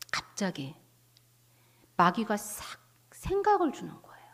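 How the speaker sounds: breathy storytelling whisper, drawing listeners in; dramatic whisper. Acted. Preacher whispers to the congregation as the devil creeps in, hushed. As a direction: hush it as if telling a secret.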